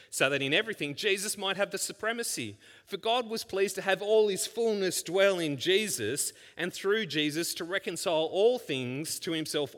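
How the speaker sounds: rising pulpit fervour; fervent preaching. Acted. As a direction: preach it, building in force. Preacher building to a climax.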